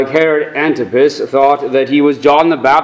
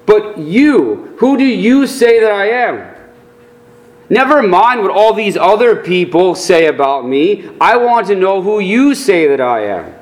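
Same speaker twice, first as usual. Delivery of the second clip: pressing, higher and insistent; emphatic; 4 semitones higher than usual. Acted. Jesus presses his disciples, emphatic and insistent. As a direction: push the question home. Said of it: "and it's emphatic".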